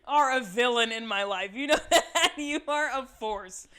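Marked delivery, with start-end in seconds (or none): 1.5-3.7 laughing through the words, loud. Real, not acted. Host laughing at her co-host, calling him a villain. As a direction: scold him while cracking up.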